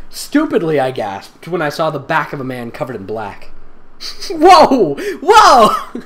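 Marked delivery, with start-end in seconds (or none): 4.3-6.0 high, loud startled whoa; startled shout. Real, not acted. Reader shocked by the story's twist. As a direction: yelp in shocked surprise. Reacting loudly to silly story.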